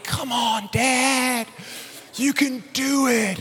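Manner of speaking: lightly voiced kids rooting for Dad; imitated cheering kids. Kids urging their pinned dad on, imitated cheering.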